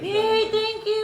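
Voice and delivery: very high, gleeful cheer; excited thanks. Real, not acted. Thanking a partner for a monthsary gift, excited.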